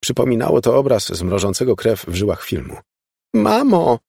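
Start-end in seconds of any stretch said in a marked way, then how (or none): 3.3-4.0 very high, loud wail; anguished moan. A narrator's reading. Elizabeth calls after her fleeing mother, anguished wail. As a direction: wail it, desperate for breath. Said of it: "jęknęła, łapiąc kurczowo oddech".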